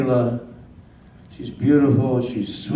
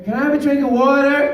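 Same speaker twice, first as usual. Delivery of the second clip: put-on high, called-out little girl's voice from upstairs; little girl calling; 13 semitones higher, 5 dB louder than usual. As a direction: call up high like a small child from her bed.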